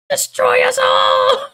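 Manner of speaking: high, slow screaming villain imitation; cartoon villain scream. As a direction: scream it like a cartoon doom prophet. Repeating cartoon villain line.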